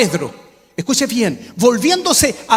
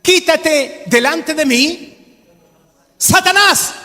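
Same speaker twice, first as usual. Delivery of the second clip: preacher voicing Jesus, shouted rebuke; rebuking shout; 6 semitones higher, slower than usual. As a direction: cast Satan out with a shouted command. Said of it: "¿Y cómo le grita?".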